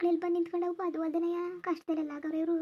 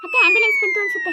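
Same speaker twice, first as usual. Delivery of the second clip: much higher, louder, squeaky retake; 9 dB louder than usual. Acted. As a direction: say it in a tiny squeaky voice. Same line retaken. (ಕೀರಲು ಎತ್ತರದ ಧ್ವನಿಯಲ್ಲಿ)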